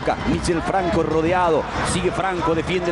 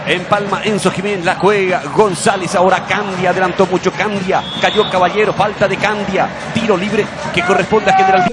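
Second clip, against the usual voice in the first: loud commentary; 7 dB louder than usual. Real, not acted.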